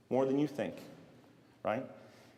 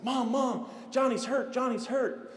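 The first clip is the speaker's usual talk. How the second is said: put-on high-pitched kid raising the alarm; mock urgent calling; 12 semitones higher, slower than usual. Boy in the hallway telling mom Johnny's hurt, mock frantic. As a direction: imitate a frantic child yelling for help.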